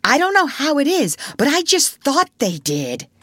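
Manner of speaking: put-on high, loud childish outburst; excited interruption. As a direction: break in eagerly like a boy. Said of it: "Curly broke in excitedly".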